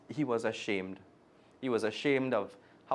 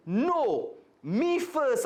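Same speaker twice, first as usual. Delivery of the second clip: put-on high, insistent kid's protest; boy protesting he should bat first; 14 semitones higher, 5 dB louder, much slower than usual. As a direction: protest like a child who wants his turn. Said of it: "I protested".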